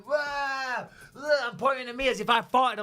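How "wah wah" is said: high, hammed-up whiny "wah, wah"; mock whining crowd. DJ mocking the clubgoers who blamed him, high whiny imitation. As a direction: mimic clubgoers whining at the smell, pitched high. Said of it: "And they're going".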